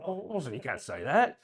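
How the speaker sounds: put-on British accent; mock British chiding; Henry Jaglom. Lunch companion imagined as British chiding Orson, put-on British accent. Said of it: "He's probably British".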